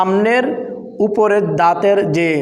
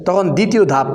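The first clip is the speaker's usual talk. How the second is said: ordinary talk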